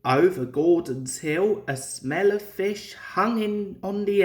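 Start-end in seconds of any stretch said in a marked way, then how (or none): none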